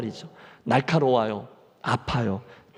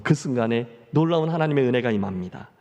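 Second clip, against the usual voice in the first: usual speaking voice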